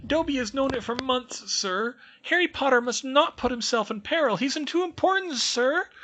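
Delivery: very high, loud squeaky elf voice, urgent; a tiny servant pleading a warning; anxious house elf. Acted. House elf warns Harry, squeaky and urgent. Dobby's voice held.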